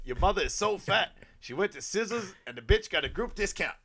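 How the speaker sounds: loud, high stand-up setup and punchline; insult comic act. Retold or imitated. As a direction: deliver it like roasting someone from a stage. Acted insult-comic joke on stage.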